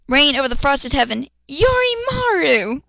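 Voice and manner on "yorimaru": high, slow drawn-out call; mock battle cry. Toshiro summoning his sword, high and slow.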